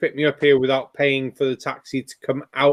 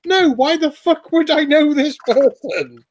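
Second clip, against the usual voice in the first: high, slow, incredulous mock outrage; exasperated retort; 11 semitones higher, 3 dB louder, slower than usual. Answering people who assume you know everyone, hammed-up exasperation. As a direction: stretch it out in comic exasperation. Acted retort to silly question.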